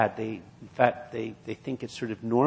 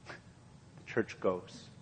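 slow, breathy whisper of the repeat; hushed whisper; 5 semitones lower, 12 dB quieter, much slower than usual. Acted. Preacher hushes the repeated line to the congregation, slow whisper. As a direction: let it sink in, barely voiced.

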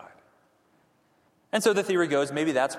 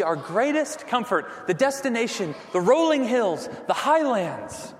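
rising fervour; 6 semitones higher, 4 dB louder, slower than usual. Real, not acted. Sermon building to climax.

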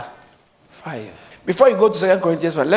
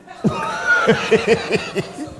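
squealing laugh; high laugh. Real, not acted. Laughs after joke.

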